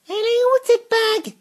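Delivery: very high, very loud swooning cry; bearded lady calling. A narrator's reading. Sophie spots Vénou across the class, swooning cry. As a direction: call out Vénou in adoration. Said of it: "demanda-t-elle sans embâge".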